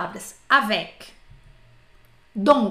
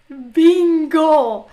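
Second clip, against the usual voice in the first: loud, triumphant Bingo; excited shout; 4 semitones higher, 9 dB louder than usual. Retold or imitated. Tourist who finally understood the waiter, shouting bingo. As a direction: blurt it out like winning a prize. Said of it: "Eu falei".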